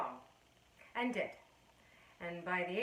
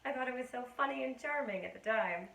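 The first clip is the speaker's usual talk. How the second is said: usual speaking voice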